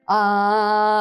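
long resonant ah from the chest; open ah sound. Shown as a demonstration. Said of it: "you may make the sound".

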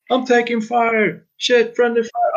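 high, slow, panicked soldier yell; battle shout. Shown as a demonstration. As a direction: cry out under fire. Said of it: "around the lines of".

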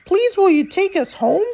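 put-on high children's voice, asking eagerly; children pleading. A narrator's reading. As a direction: ask like a hopeful little child.

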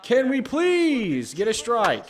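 loud, high, drawn-out pleading; exasperated plea. Real, not acted. Player begging for a strike, exasperated plea. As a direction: beg loudly, stretching the words.

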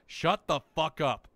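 loud, slow, punched-out words; slow, forceful mock yell; retold outburst. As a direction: raise pitch and volume, hammering each word. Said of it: "then you say".

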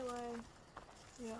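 usual speaking voice

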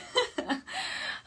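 high, breathless burst of laughter; real laughter. Real, not acted. Streamer tickled by working on Valentine's, high laughing fit. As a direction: crack up at your own answer. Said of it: "qué, qué risa".

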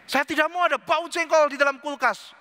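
high, insistent mock protest; indignant objection. Wife refuses jengkol smell in the fridge, indignant.

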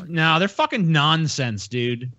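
usual speaking voice

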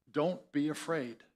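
quietly reassuring "Don't be afraid."; gentle reassurance. Shown as a demonstration. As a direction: soothe a startled person. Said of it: "you might freak out".

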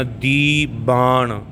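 ਦੀਬਾਨ drawn out as dee-baan; slow ਦੀਬਾਨ with long dee and firm b; firm pronunciation. Shown as a demonstration. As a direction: stretch both syllables of ਦੀਬਾਨ, falling at the end. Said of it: "ਇਹਨੂੰ ਇਸੇ ਤਰ੍ਹਾਂ ਹੀ ਬੋਲਣਾ".

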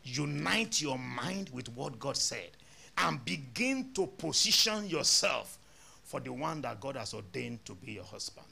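ordinary delivery

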